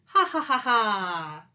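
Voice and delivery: mock scheming ha-ha-ha; stagey gloating laugh, last note drawn down. Skunk gloats over his trap.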